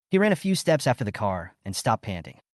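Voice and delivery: ordinary delivery, nothing marked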